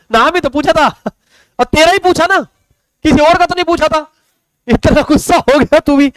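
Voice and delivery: high-pitched mock hurt protest to the student; mock indignant. Teacher to student who answered curtly, high mock-hurt whine. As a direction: whine it up high, pretending to be wronged. Teasing student for angry reply.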